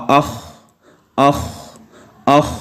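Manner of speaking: اخ with the kh trailing off on air; اخ said softly, air rushing out; soft breathy kh. Shown as a demonstration. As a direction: let the breath carry the خ out. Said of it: "جب خا پڑھیں گے تو پھر اسی طرح ہوگا".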